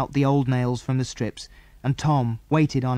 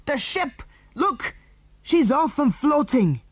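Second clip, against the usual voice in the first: high, thrilled cry without much volume; excited shout; 12 semitones higher, slower than usual. A narrator's reading. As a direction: call out the discovery, drawing out the last words. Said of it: "he gave a gurgling shout".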